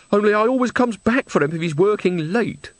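high, plaintive protest; fretful old woman. A narrator's reading. Mother explains to Granny about her son, fretful. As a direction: explain anxiously, pitch well up.

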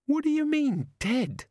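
demanding. A narrator's reading. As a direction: insist on an answer. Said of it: "Susan demanded".

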